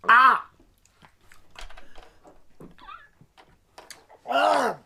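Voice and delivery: loud pained cry; pained yelling. Real, not acted. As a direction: yell out from the sour burn. Reacting to sour citric acid.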